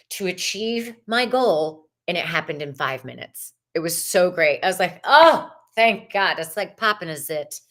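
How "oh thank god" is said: loud, drawn-out sigh of relief, re-enacted; retold relief. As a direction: replay the relief, leaning long on "Oh". Retells relief when app finally worked.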